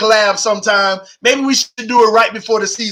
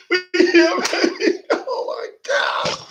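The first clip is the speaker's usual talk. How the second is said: squealing with joy; 8 semitones higher, 5 dB quieter than usual. Real, not acted. Overcome imagining the win.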